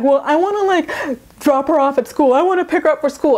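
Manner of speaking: high, whiny mock of the mom; high mocking whine, quoting the ex. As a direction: whine it like a petulant mom begging. Mocking Amber's whiny plea.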